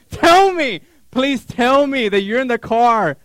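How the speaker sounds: loud, high desperate plea; pleading. Retold or imitated. Husband begging wife on the phone, pleading.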